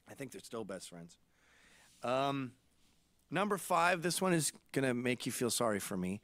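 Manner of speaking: usual speaking voice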